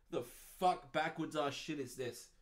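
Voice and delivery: normal talking voice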